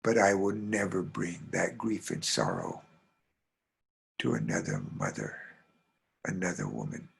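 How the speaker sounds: deeply touched. Acted. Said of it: "her heart was deeply touched".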